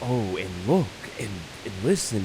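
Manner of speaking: soft, hushed and marvelling, listen listen; drawing you in quietly to hear; hushed awe. Real, not acted. Player hushing the audience to hear the wind, softly.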